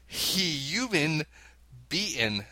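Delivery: HE HUMAN BEATEN, very slow and split up; regional pronunciation. Shown as a demonstration. Host reading a regional version, slow hee-yuman bee-en. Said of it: "it would be pronounced as".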